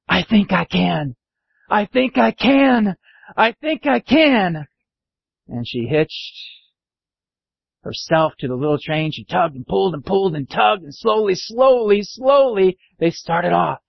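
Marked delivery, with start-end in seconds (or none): 0.1-4.7 slow, deliberate, rising in pitch; measured hopeful refrain; tentative resolve. Acted. Engine resolves to try. 10.9-12.8 slowly straining. A narrator's reading. Train slowly starting off.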